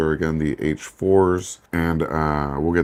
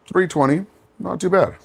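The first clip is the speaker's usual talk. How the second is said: pleased surprise at the number; pleasantly surprised; 11 semitones higher than usual. Real, not acted. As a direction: voice jumps way up, delighted. Surprised by a good speed result.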